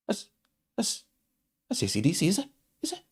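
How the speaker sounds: halting mock recognition in broken bits; hammed-up hesitant wondering; fake surprised reaction. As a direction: act out badly faked dawning recognition. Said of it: "it's the fakest reaction".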